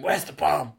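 gruff Jack Bauer growl; gravelly voice. Retold or imitated. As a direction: rasp it out like an action hero. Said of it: "I had a gravelly voice".

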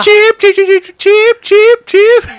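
a silly high cheep cheep; high squeaky bird chirps. Pretend.

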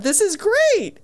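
high-pitched enthusiastic verdict; retold delight. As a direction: recall being thrilled, pitch jumping up. Quoting own delighted reaction.

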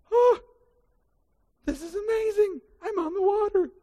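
high, awed delight; amazed wonder. Acted. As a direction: marvel at walking on water. Peter amazed walking on water.

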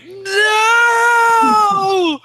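long, high wailing "no"; anguished scream. Acted. Player as man whose fingers pass through her, anguished scream. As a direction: cry out in despair, loud and stretched. Acted anguished cry as she vanishes.

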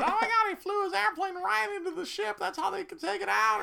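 very high, excited mock yelling; high-pitched retold hollering; imitated screaming. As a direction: mimic a loudmouth teen gushing after a movie. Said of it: "screaming about".

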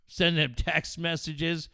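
ordinary delivery

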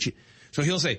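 ordinary talk, nothing marked

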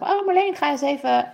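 high, coaxing "Oh, Marleen" like a friend egging her on; playful pleading friend; teasing friend. Retold or imitated. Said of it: "mijn vriendinnen maken wel eens grap". (plagerige vriendin)

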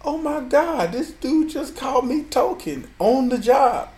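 high-pitched, incredulous inner voice; retold shocked reaction; stunned disbelief. Retelling his stunned inner reaction.

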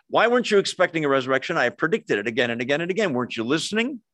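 rapid-fire scolding questions; exasperated telling-off, hurried; mock rebuke. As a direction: rattle off a reproach at speed. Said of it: "There's no rebuke for".

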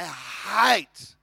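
"hate" drawn out long and loud; emphatic hate. Real, not acted. Preacher on talking about baseball, emphatic stretched "hate". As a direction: lean hard and slow on "hate". Drags out his hatred of baseball.

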